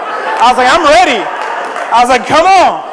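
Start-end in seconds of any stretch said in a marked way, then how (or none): 0.7-1.2 loud, raised-pitch bravado; retold defiant challenge. 2.3-2.8 loud, higher taunting call; retold dare.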